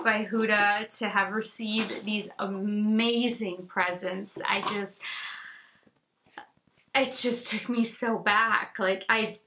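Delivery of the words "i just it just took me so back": ordinary delivery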